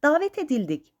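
high, lively announcement; eager high-pitched call; calling out news. A narrator's reading. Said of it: "diye seslendi".

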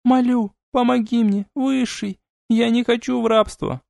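put-on high, pleading young demoness; silvery girlish voice. A narrator's reading. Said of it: "серебристый голосок".

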